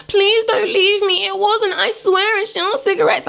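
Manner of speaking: high, wailing mum voice; imitation of a frantic woman pleading; mock desperate pleading. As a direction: beg him in a drawn-out high whine. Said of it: "she's chasing after the boyfriend".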